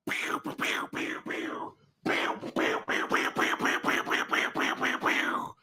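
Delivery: rapid voiceless pew-pew firework noises; finger-gun pews. Pretend. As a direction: mouth toy gun shots, no voice. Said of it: "Those are my finger guns to go along with fireworks".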